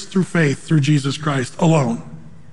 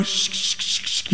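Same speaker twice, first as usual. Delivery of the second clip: soft whispered shushes; imitated string of quick shushes; hushing; 9 dB quieter than usual. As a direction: shush them under your breath. Mimics people hushing new believers.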